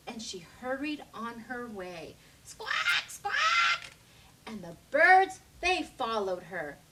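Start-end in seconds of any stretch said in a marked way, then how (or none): 2.4-3.9 shrill bird screech. A narrator's reading. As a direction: screech like greedy birds. Birds squawking.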